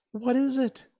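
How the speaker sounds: high, eager question; surprised girl. A narrator's reading. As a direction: ask it with wide-eyed wonder. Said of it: "answered Dorothy in surprise".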